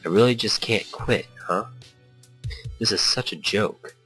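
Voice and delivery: ordinary talk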